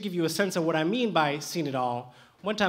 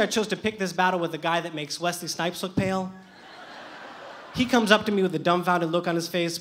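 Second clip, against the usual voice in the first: usual speaking voice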